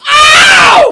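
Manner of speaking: very high, loud holler; hammed-up excited howl; reenacted fan scream. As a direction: scream it with total abandon. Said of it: "I was like".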